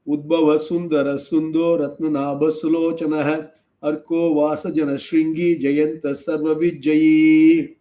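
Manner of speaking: quick chanted Sanskrit verse; fast devotional chant; shloka recitation. Shown as a demonstration.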